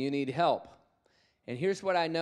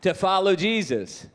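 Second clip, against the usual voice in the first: 1 call to repeat; 5 semitones higher, 7 dB louder, slower than usual. Real, not acted.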